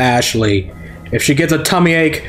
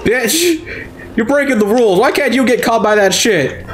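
shrill outraged yelling; high pitched rage; 8 semitones higher than usual. Real, not acted. Player raging at an enemy breaking the rules, high and outraged.

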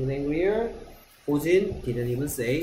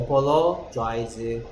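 slow modelling; 3 semitones lower, much slower than usual. Shown as a demonstration. Teacher modelling apologize slowly for students. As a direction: apologize drawn out slowly, syllable by syllable. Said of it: "teacher modelling word".

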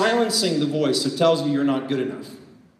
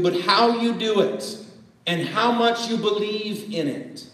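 ordinary talk, nothing marked

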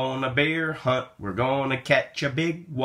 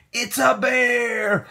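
alarmed; 9 semitones higher, slower than usual. Acted. The bear is revealed.

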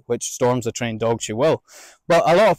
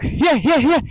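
high, excited yeahs; quick excited cheer; cheering the dog; 12 semitones higher, 3 dB louder, slower than usual. Shown as a demonstration. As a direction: urge the dog on.

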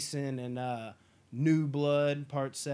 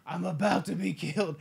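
louder strained put-on plea; mock Seagal insisting; 3 semitones higher, 6 dB louder than usual. Seagal insisting to the staff, strained loud mock plea. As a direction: insist you're about to die. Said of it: "He's just like".

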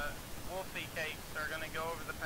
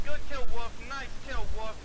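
excited praise; 6 semitones higher, 15 dB louder, slower than usual. Real, not acted. Praising teammate's kill.

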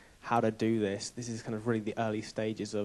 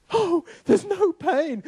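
very high, loud, drawn-out amazement; hammed-up astonished shriek, imitating the shock; completely shocked; 10 dB louder, much slower than usual. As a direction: cry it out slowly in utter shock. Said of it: "We're, like, completely shocked".

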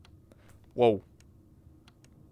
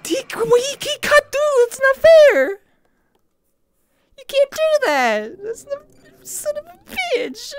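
very high, squealing complaint, fading at the end; whining protest; 3 dB louder than usual. Real, not acted. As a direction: wail that you've been cheated. Protests being caught in game.

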